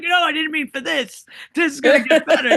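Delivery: high, loud imitation of a stubborn dad; mock protest. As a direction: insist it's fine, hammed-up and loud. Acting out dad refusing to go.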